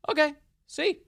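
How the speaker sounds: high, bright mock reassurance; teasing singsong; mock cheery official. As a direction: toss it off like a smug salesman. Mock governor showing the map.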